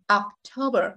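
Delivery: October with a weakened Oc and a weighty TO; ocTOber, first syllable reduced; heavy stressed syllable. Shown as a demonstration. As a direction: press on the middle of October. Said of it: "nặng nặng ha".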